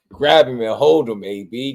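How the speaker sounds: loud shouted advice at a losing fighter; yelling at the TV. Retold or imitated. A fan yelling at his fighter on TV, loud shout. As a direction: bark the order at the screen.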